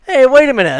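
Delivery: suddenly catching something fishy; surprised exclamation. Pretend. Mock discovery, growing suspicion.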